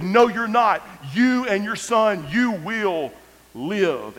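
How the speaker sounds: high, loud, then slowing proclamation; bold declaration. Retold or imitated. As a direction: declare it with total faith. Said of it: "declare a very bold statement".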